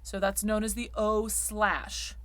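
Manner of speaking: normal talking voice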